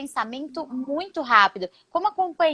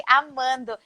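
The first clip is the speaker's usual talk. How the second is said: ordinary delivery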